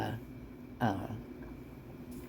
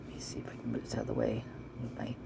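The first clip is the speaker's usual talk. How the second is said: whispering; 8 dB quieter, faster than usual. Real, not acted. Muttering while moving windows.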